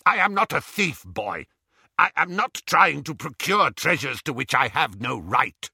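indignant goblin, high and forceful; angry. A narrator's reading. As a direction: protest angrily as an affronted goblin. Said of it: "Griphook flushed angrily".

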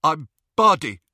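high, loud, thick-tongued words; mouth full of blood. A narrator's reading. Ron after a punch, mouth full of blood. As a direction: force it out through a bloodied mouth. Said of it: "his mouth was full of blood".

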